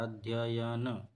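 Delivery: अध्ययन drawn out very slowly, each syllable clear; slow careful spelling. Shown as a demonstration. Teacher slowly saying the correct अध्ययन for students. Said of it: "ये वाला सही है".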